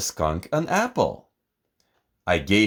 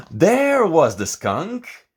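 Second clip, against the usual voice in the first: surprised; 8 semitones higher, 4 dB louder, slower than usual. A narrator's reading. Discovery after gasp.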